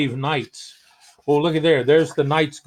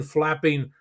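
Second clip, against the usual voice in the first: ordinary delivery, nothing marked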